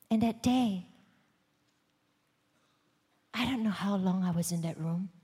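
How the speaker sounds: normal talking voice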